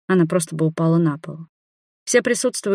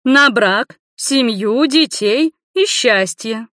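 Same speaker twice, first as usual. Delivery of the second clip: high, loud list slowing to the end; emphatic blessing; 6 semitones higher, 4 dB louder, much slower than usual. A narrator's reading. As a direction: proclaim each blessing in turn. Grandmother answers with a blessing.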